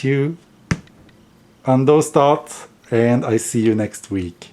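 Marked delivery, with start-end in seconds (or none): none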